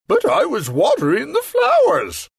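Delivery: very high put-on king's protest. King protesting to the grumpy magician. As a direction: whine the excuse. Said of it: "the king said".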